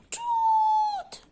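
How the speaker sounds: high squealed elephant toot; playful trumpeting toot. Shown as a demonstration. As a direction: make the elephant sound for children. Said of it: "بعمل sound".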